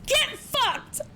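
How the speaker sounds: high, gleeful yell; excited yell. Real, not acted. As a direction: cheer at the screen. Excited cheer at a cutscene.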